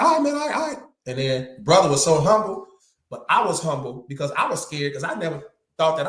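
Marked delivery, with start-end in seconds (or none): 0.0-0.8 high-pitched mock pleading; imitated hasty surrender. Big man submitting to the smaller fighter, imitated high pleading. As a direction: give in high, repeating it urgently.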